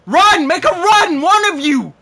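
high, loud shouting at players; frantic yelling at his strikers to run; yelling orders. Real, not acted. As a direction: bark it at the screen.

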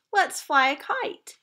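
high, slow cheerful call; shouting. Acted. Laura calling the kids to fly the kite, high cheerful call. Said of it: "Laura pulled the kite out of the trunk and shouted".

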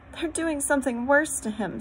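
put-on high, anxious child's voice; distressed. A narrator's reading. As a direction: fear for a friend being hurt. Lucy distressed for Aslan.

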